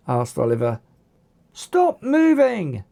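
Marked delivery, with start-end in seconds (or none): 1.5-2.8 slow, high, forceful command; shouted. A narrator's reading. Zack ordering Oliver to freeze. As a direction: bark it slowly at him. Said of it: "shouted Zack".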